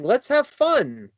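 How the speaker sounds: high, drawn-out upbeat call; excited rallying call. Retold or imitated. Wrestler to tag partner walking into the match, excited. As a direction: say it high and slow, like a cheer. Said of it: "We walked in saying".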